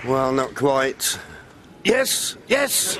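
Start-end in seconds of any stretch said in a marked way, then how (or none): 1.8-3.0 high cheering yes; excited. Real, not acted. Break nears century, cheering.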